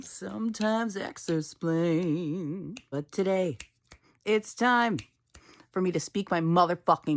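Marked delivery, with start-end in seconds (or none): 0.0-2.7 Gen X voice. Pretend. Mock Gen X voice, far, slow.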